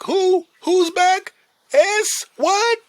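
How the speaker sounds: very high, loud calls; excited worker calling out. Retold or imitated. Back-room worker calling out on hearing the news, very high.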